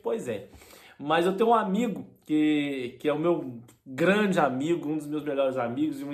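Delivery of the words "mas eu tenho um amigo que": ordinary delivery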